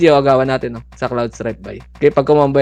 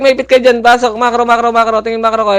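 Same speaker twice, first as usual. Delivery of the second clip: high, loud string of repeated commands; urgent shouted orders; 8 semitones higher, 6 dB louder than usual. Real, not acted. Player calling squad back and in, urgent shouting. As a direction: shout rapid orders, voice pitched way up.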